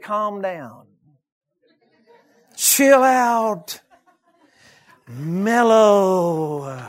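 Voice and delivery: drawn-out, settling words with long pauses; mock calming down. Doctor friend telling the fiery preacher to relax, mock-soothing. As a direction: imitate someone slowly talking you down.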